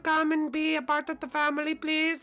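high, fast, pleading whine; whiny Bowser voice. Acted. Bowser begging the others to invite him, high whiny plea.

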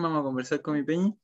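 ordinary talk

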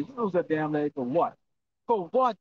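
ordinary delivery

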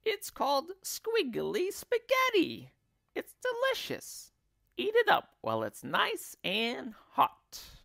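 very high-pitched wife voice; coaxing wife. Acted. Wife urges husband to eat wormy spaghetti, high coaxing. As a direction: sell it sweetly in a high squeaky voice.

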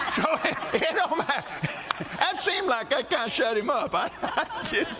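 laughing through the words, high up. Real, not acted. Preacher laughing to the congregation after his toe story. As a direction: chuckle out the words after the punchline.

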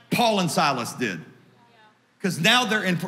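ordinary talk

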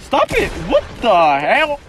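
high, loud panicked outburst; high panicked yelp. Real, not acted. Gamer panicking as a hacker attacks.

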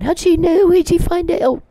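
high, squeaky mock whine; mocking whiny voice. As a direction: sneer at him in a high whiny voice. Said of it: "run around going".

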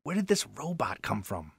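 soft whispered jibe; boy whispering in class. Acted. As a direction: mutter it behind a hand. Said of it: "one boy whisper".

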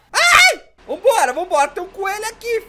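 sharp, high, loud yelp; startled cry. Real, not acted. Player startled on the road, high loud yelp. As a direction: jump at the sudden shock. Sudden scare while driving.

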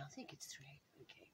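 unvoiced whispered aside; whispering. Real, not acted. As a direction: whisper it to yourself. Counting aside under breath.

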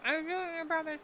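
talking while chewing; words muffled by food; mouth full. Real, not acted. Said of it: "I'm gonna eat".

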